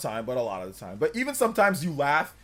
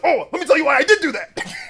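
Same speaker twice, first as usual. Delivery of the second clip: louder, higher mock gloat; smug villain; 11 semitones higher, 11 dB louder than usual. Streamer voicing the villain gloating to the loser, smug. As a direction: lord it over him, hammed up. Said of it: "He's like".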